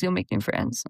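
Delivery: low, quiet imitation of friends saying you'll make new friends; mock low echo. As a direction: mumble it back like a tired cliché. Friends reassuring her about moving.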